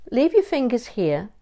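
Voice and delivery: ordinary delivery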